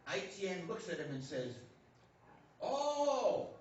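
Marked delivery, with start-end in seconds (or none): 2.6-3.5 a loud, high exclamation of realization; surprised oh. Acted. Ai Tian realizing what the painting shows, surprised. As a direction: let it burst out as it dawns.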